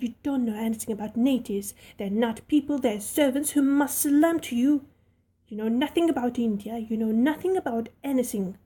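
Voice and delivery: put-on spoiled girl raging at her maid. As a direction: lash out in humiliated rage. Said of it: "rage and humiliation".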